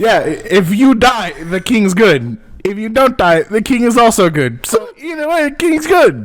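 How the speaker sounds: excited. Real, not acted. Arguing point, rising emphatically.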